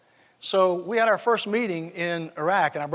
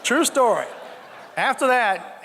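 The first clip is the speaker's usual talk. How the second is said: laughing; 5 semitones higher, slower than usual. Real, not acted. Speaker to laughing audience, laughing. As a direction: chuckle it out to the room.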